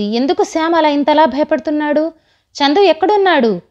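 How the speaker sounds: anxiously demanding where her son is. A narrator's reading. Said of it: "వాయిస్ని పెంచి భయపడుతూ కంగారుగా".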